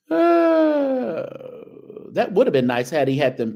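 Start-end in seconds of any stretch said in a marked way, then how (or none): 0.1-1.2 burst of laughter. Real, not acted. Reacting to the outfit.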